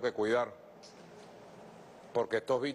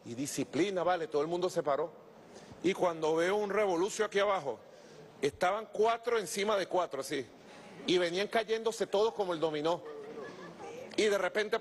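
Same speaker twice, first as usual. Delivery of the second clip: ordinary talk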